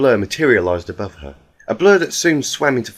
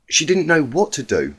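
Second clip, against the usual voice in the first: usual speaking voice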